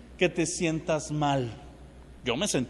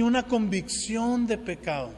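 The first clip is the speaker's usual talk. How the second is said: ordinary talk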